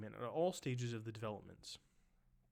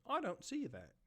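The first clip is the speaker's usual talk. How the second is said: put-on British accent, pitched higher; 7 semitones higher than usual. Reader repeating the objection, British accent. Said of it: "that's in a British accent".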